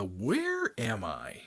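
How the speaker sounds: slow, drawn-out "where", puzzled; retold bewilderment. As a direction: stretch it out as if slowly realising you're lost. Retold moment of getting lost.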